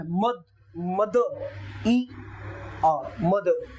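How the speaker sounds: मदर split slowly, ending without आर; slow phonetic breakdown. Shown as a demonstration. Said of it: "phonetics language है वो क्या है".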